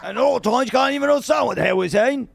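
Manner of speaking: high, cheeky Cockney accent. Shown as a demonstration. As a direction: show off your Cockney accent. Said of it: "Can you do a Cockney?".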